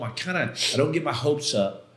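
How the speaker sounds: usual speaking voice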